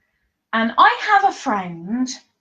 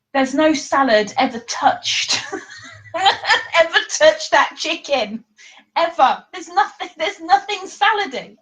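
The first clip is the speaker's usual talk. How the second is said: higher, laughing indignation over "salad"; laughing disbelief; 6 semitones higher than usual. Real, not acted. Mocking her friend's salad-free chicken salad, laughing. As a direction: protest it through laughter.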